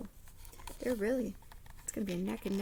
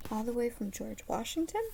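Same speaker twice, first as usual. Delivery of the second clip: usual speaking voice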